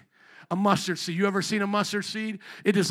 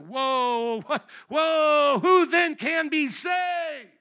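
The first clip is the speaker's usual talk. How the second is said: higher, louder, slow alarmed cry; panicked Peter; 7 semitones higher, 3 dB louder, slower than usual. Retold or imitated. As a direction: freak out as Peter. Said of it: "Peter's like".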